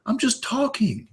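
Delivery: high, protesting husband, slowing at the end; mock indignant husband; imitating the husband. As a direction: plead innocence as the baffled husband. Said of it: "the guy says".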